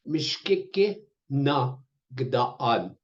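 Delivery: drawn out, syllable by syllable; very slow "Mishkike na gdaan"; slow teaching pronunciation. Shown as a demonstration. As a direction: model it slowly for learners. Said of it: "teaching question".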